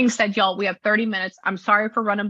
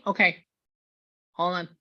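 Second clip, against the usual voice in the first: normal talking voice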